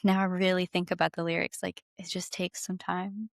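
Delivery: ordinary talk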